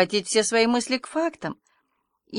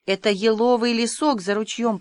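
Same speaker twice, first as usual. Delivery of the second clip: plain reading voice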